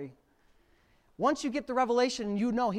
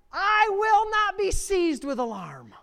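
preached line; 11 semitones higher, 7 dB louder, slower than usual. Acted. Preaching the verse.

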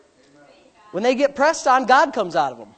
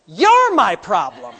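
retold snap; 7 dB louder, much slower than usual. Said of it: "I said".

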